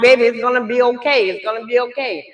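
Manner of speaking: ordinary talk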